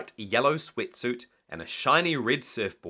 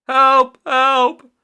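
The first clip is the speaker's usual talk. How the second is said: high, loud cry for help; 14 semitones higher, 15 dB louder than usual. A narrator's reading. Mister Grinling panics in the water, high loud cry for help. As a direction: yell help as if drowning. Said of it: "cried Mister Grinling".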